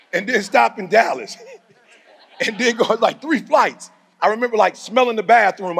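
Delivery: high, loud, excited. Real, not acted. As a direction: build it up with energy.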